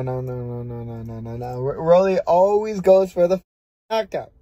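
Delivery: ordinary delivery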